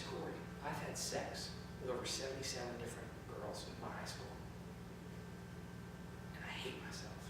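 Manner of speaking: hushed, near-whispered tearful confession; teen confessing through tears. Retold or imitated. Youth confessing to his pastor, mild tearful hush. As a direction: let it fall to a broken hush. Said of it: "I notice he's crying".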